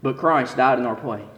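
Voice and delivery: slow, hushed, weighty; solemn preaching. Acted. Preacher drawing the lesson, solemn and slow.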